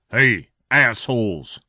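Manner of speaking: restrained shout in a story voice; narrated shout. A narrator's reading. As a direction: call them out, without full volume. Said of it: "Clint shouted at the three men".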